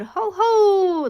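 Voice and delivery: spooky pumpkin cackle, loud; dreadful laugh. Acted. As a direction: cackle like a scary jack-o'-lantern. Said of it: "laughed a dreadful zigzag laugh".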